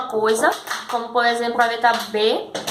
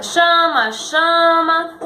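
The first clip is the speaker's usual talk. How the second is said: calling out; 4 semitones higher, 3 dB louder, much slower than usual. Acted. Father calling his son.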